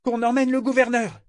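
very high-pitched call; shouted order. A narrator's reading. Yémin to his crew, shouting an order. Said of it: "il cria à l'équipage".

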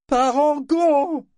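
high, drawn-out call; crying out. A narrator's reading. Woman hails the weeping ship, crying out high and long. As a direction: shout the name, long and high. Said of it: "s'écria Amis Lutchance".

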